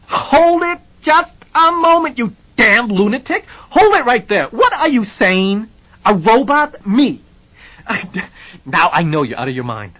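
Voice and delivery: enraged bellowing client; furious Hennessy. Acted. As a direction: explode out of the chair. Hennessy scarlet, rising from chair.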